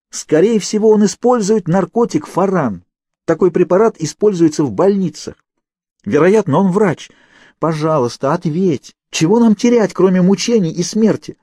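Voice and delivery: high, loud pleading reasoning; loud urgent explaining. A narrator's reading. Kate shouting to Naomi about the drug and the doctor. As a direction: make the case to answer.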